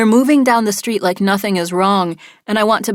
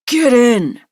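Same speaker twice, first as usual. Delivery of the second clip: sharp, louder hissed command; hissed order; 4 semitones higher, 5 dB louder than usual. A narrator's reading. Kidnapper shoving her into the car, hissed command. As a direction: spit the order through the teeth. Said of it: "he hisses".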